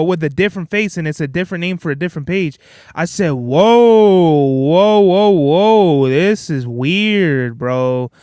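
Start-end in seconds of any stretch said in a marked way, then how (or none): 3.3-8.1 long loud whoas sinking into a slow, low finish; mock astonishment. Reacting to a different face on the same videos, mock astonishment. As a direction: draw out a stunned, put-on whoa. Retold startled reaction to fake page.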